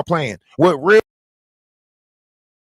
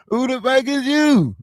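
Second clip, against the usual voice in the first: high-pitched, incredulous mock reply; mock bafflement; 5 semitones higher than usual. Replying to someone confessing undying love, mock bafflement. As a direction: act totally stumped by the caller.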